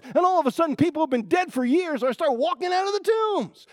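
fiery preaching. Acted. Preacher to church, fiery full force preaching. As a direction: build the wonder of the earthquake.

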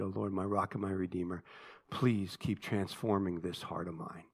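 low, soft, sincere praying voice; hushed closing prayer. Real, not acted. Preacher asking God to transform his heart, soft low prayer. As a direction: bring it down into quiet personal prayer.

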